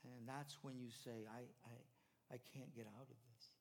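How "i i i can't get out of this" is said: much softer, faltering confession; helpless. Acted. Believer admitting to God he is stuck, soft and faltering. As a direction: barely get the words out, defeated.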